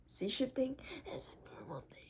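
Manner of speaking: soft voiceless whisper. Real, not acted. Whispering to viewers that shifting is normal. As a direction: lean in and whisper it.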